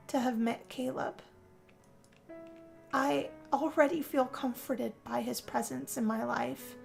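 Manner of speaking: ordinary talk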